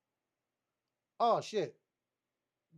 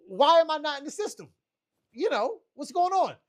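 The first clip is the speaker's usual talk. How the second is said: indignant disbelief; 5 semitones higher, 5 dB louder than usual. Retold or imitated. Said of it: "Now, I'm asking".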